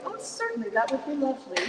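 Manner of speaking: mock composed reply, high and airy; very calmly. Accepting the house-sitting offer, playing it cool. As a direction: hold back the excitement and sound cool. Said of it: "And I said very calmly".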